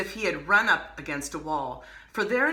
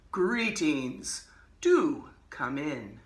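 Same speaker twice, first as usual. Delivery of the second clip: slow, sinister magician greeting; 3 semitones lower, much slower than usual. Acted. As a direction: draw it out like a menacing host. Magician greets boy at door.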